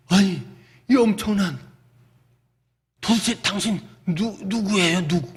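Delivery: acted stutter of astonishment; halting, broken, disbelieving question; flustered stammering. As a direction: stumble over the words as if stunned. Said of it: "말을 더듬는 거죠".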